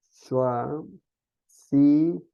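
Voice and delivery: "Choisissez" stretched very slowly; slow teaching model of the word; drawn-out model word. Shown as a demonstration. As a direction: draw out every syllable of "Choisissez" for the class. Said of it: "teacher models the verb".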